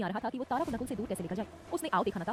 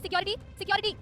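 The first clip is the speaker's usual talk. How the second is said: बहुत ऊँची आवाज़ में पुकार; सिक्योरिटी को ज़ोर से बुलाती हुई चीख; चिल्लाना; 9 semitones higher than usual. A narrator's reading. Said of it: "जोर जोर से चिल्लाने लगी". (ऊँची चीख़ती पुकार)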